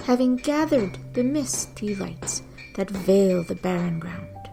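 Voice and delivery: usual speaking voice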